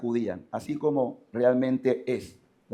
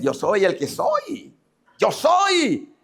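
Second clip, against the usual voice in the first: high, slow, ringing declaration; God proclaiming; 6 semitones higher, much slower than usual. Acted. The Lord presenting himself to Moses, high solemn proclamation. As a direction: declare it as God, rising higher. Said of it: "le presenta el Señor así".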